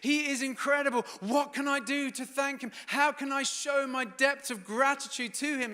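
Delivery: high, loud, breathless wonder; amazed gratitude. Retold or imitated. Mary watching Lazarus eat with Jesus, thrilled and grateful. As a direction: gush with thankful amazement. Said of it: "she's like".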